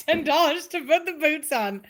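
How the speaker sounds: laughing through the words; giggling refusal. Real, not acted.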